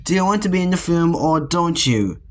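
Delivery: usual speaking voice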